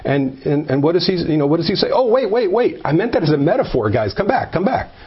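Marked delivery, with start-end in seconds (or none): 1.9-4.9 higher, hammed-up plea to come back; mock pleading. Preacher mocks Jesus calling back the leaving crowd, high mock plea. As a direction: call after the departing crowd in mock panic.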